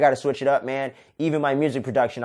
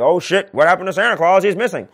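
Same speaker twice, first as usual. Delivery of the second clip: louder, higher alarmed townsperson; mock panic; 4 semitones higher, 6 dB louder than usual. Christmas Town folks panic about missing Santa, loud mock alarm. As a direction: play up the panic over Santa.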